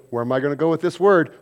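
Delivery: ordinary talk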